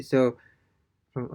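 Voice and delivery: ordinary talk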